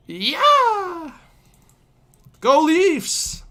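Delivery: loud high fan cheer; cheering shout. Real, not acted. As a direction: whoop for the Leafs. Cheering for his team.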